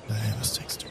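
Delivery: hushed whisper to a friend. Acted. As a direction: lean in and whisper it. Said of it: "ich flüster zu".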